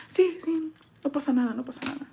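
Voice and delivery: small, high, soft put-on voice; mock meek. Acting out a meek client.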